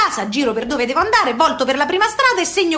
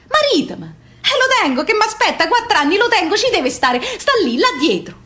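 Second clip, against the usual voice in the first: eager answer; 8 semitones higher than usual. Acted. Eager answer to immigration officer.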